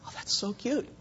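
put-on soft doting aside about a little girl; doting coo. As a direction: melt over the child as you say it. Father melting over a little girl.

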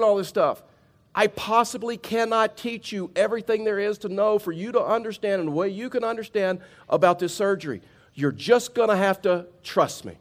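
surgeon friend. Retold or imitated. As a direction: mimic the surgeon holding up his hands to stop the questions. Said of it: "looked at me and says".

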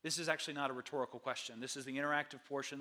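normal talking voice

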